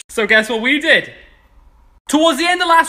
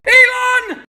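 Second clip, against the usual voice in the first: shouting Elon; 10 semitones higher than usual. Acted. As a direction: yell it across the room. Recurring shout-out gag.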